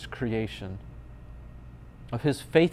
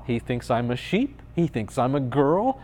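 ordinary talk

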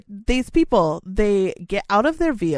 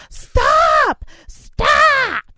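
shrill high yelled stop, much higher and louder; imitated shout; 8 dB louder than usual. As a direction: bark it like a self-made traffic warden. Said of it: "throws her fucking hands out and goes".

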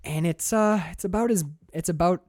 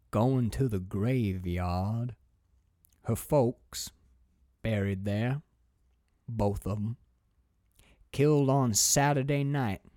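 put-on slow, lower old country woman with long pauses; old woman's voice; 4 semitones lower, 4 dB quieter, slower than usual. Old woman to the driver, slow and low-pitched. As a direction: drag each phrase out slowly and lower. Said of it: "I'll try and do her voice too".

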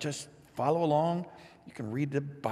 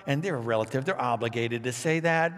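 ordinary delivery

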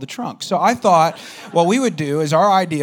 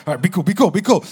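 retold urgent warning; 3 semitones higher, 6 dB louder, faster than usual. Friend urging calm as she nears.

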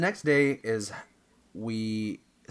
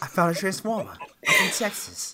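high, laughing fake Texas accent; Mark Wahlberg; 5 semitones higher than usual. Star claims he's from Texas, hammed-up Texan accent. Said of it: "can you do a Texas accent?".

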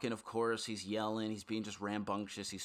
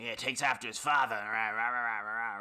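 louder mock boast trailing into rah-rah gibberish; imitating crazy man; 5 dB louder than usual. Storyteller mimics the man bragging about his son, crazed rambling. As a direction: play a raving proud father, dissolving into noise. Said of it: "he's, like, really crazy".

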